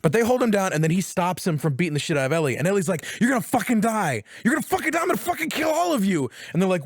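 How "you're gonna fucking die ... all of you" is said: rising, high-pitched mock rage; acted furious threat. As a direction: threaten them while pinned down, pitch climbing. Acting Ellie threatening her captors.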